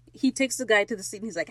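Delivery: ordinary talk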